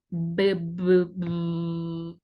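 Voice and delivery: "B, b, b" as low buzzed syllables; voiced B. Shown as a demonstration. Teacher showing English B is voiced, buzzed b. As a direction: voice the "b" and draw out the last one. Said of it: "la B es sonora".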